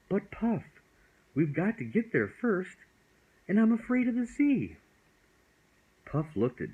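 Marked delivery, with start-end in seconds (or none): 0.1-4.8 put-on high, anxious child voice; a small boy pleading his fear; frightened little boy. Child voice afraid of sea.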